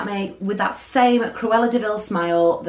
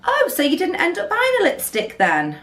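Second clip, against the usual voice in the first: mock sweet sarcasm; 11 semitones higher than usual. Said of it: "And she says".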